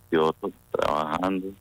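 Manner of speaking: ordinary talk, nothing marked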